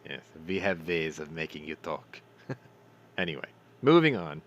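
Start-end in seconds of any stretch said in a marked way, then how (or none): 0.0-2.2 low, menacing mock German interrogator; hammed-up German accent; mock German villain. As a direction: purr the threat like a movie villain. Cartoon interrogator line.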